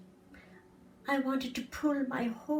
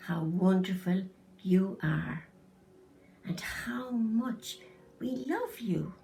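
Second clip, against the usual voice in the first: tender reassurance; 4 semitones lower than usual. Acted. Mother reassures her child.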